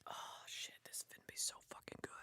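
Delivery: fast excited whisper. Real, not acted. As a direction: whisper it like a secret treat. Excited before tasting.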